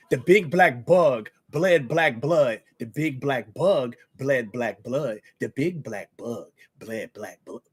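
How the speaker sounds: each word crisply enunciated; slow enunciation. Shown as a demonstration. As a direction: enunciate every word slowly. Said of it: "Just try to enunciate and say all of the words".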